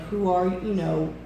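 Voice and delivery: normal talking voice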